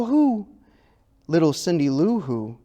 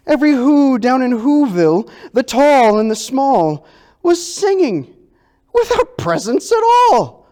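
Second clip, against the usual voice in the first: excited; 9 semitones higher, 6 dB louder than usual. A narrator's reading. Climax of shocking surprise.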